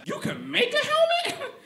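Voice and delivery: very high astonished squeak; amazed kid. Retold or imitated. Son stunned that dad can make a helmet, very high squeak. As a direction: squeak out total disbelief. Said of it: "I was like".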